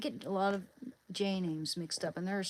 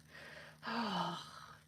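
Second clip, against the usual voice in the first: long weary sigh; exasperated sigh; 7 dB quieter than usual. Acted. Streamer showing what women put up with, exasperated sigh. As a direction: let out a drawn-out, softer sigh. Acting out mild frustration.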